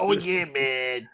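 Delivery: slow, put-on catchphrase voice; throaty oversold imitation; Booker T. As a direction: draw it out like a hammy commentator. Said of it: "My fucking Booker T impression".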